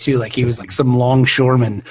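ordinary delivery

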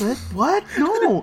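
mock outrage.

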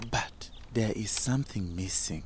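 whispered narration. A narrator's reading. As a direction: breathe it, almost silent.

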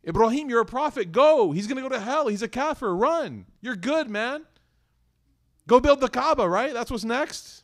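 high, loud flippant cheer; mock cheering. Careless reader urging Ibrahim on, high and loud mock cheer. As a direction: egg him on like it's nothing. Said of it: "you're like".

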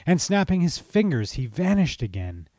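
rhyming verse read with lively lilt; storyteller reading festive rhyme; poem recital. A narrator's reading. Narrates verse.

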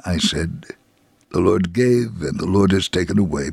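ordinary delivery

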